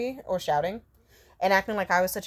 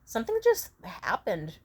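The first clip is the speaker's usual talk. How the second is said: helpless; 8 semitones higher than usual. Retold or imitated. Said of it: "I said something along the lines of".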